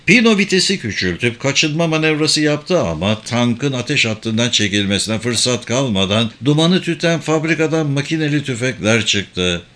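ordinary delivery

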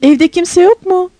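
high, slow searching call. A narrator's reading. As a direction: call to see if anyone is home. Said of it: "diye bağırdı". (evde birini arar gibi seslenerek)

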